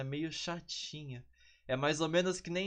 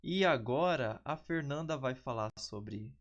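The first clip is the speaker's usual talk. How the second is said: ordinary delivery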